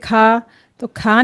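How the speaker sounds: खा with a light open aa; wrong thin kha. Shown as a demonstration. Teacher imitates how some people read it, thin खा. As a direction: say खा thin, like everyday Hindi. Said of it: "कुछ लोग उसके पढ़ते हैं".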